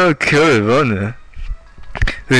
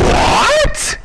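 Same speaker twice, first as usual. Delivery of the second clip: gasp, then a very high squeaked "What?"; shocked gasp. Acted. Party members reel at the reveal, squeaking in high shock.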